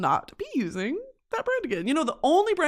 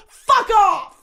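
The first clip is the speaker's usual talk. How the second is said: mock indignant shout; 11 semitones higher, 7 dB louder than usual.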